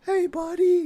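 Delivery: very high, sing-song mock of a lady coaxing a horse. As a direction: squeak it out like a silly woman calling a pet. Mocking lady calling the horse.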